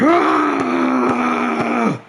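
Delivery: long, high mock scream; hammed-up victory scream, drawn out; exaggerated scream. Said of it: "I ain't scream like that".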